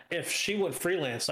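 usual speaking voice

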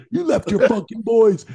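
mock shout. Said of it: "just stopped and looked at him".

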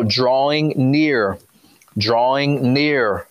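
drawn-out, deliberate repetition; slow preacher's emphasis. Real, not acted. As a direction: dwell on each word slowly. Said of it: "preacher dwells on the phrase".

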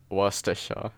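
Worcestershire in an English accent, WOSS-tuh-shuh; English pronunciation. Shown as a demonstration. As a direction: drop the r's in Worcestershire, English style. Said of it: "the English pronunciation".

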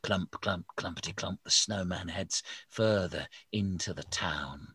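ordinary delivery

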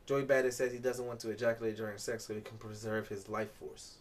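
ordinary talk

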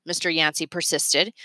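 ordinary delivery

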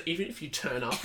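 ordinary talk

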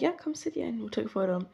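lightly voiced kindly Santa.

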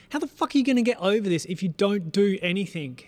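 loud, high-pitched re-enacted rant; frustrated retold outburst; exasperated yelling. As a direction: yell it like a dad at wits' end. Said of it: "sorry for yelling at you last night".

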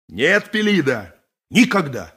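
ordinary talk

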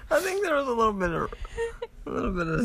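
high, squeaky voice through laughter; real laugh squeezing the words high; helpless laughter. Real, not acted. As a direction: talk fast while cracking up.

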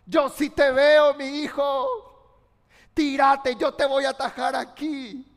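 loud, urgent father's call; urgent shout. Acted. Father shouts to his son at the smoky window, urgent. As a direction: shout up to reassure him.

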